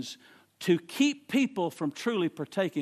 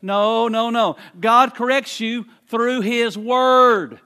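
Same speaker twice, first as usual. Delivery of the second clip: loud, slow, drawn-out no; preacher flatly rejecting sickness as correction; emphatic denial; 4 semitones higher, 9 dB louder, much slower than usual. Acted. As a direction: plant each word hard and slow.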